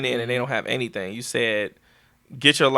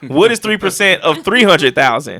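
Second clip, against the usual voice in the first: mock quizmaster; 6 semitones higher, 8 dB louder than usual. Host teasing listeners with commission sums, mock quizmaster. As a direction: pose it loudly to the whole pretend class. Mock quiz put to the audience.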